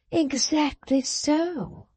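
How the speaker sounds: high, drawn-out eager agreement; eager cry. A narrator's reading. Mrs Lammle crying out eagerly to Fledgeby. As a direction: seize on his words with delight. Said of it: "cried Missus Lamel".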